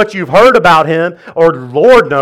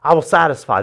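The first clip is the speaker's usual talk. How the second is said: preaching proclamation. Acted. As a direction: proclaim it like scripture. Proclaiming Jesus' words.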